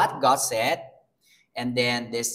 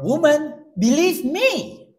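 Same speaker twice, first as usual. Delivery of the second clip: high, louder, slow earnest call; voicing Jesus; 13 semitones higher, 6 dB louder, slower than usual. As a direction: call to her with authority. Jesus quoted in sermon.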